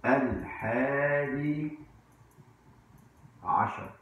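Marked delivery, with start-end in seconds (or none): none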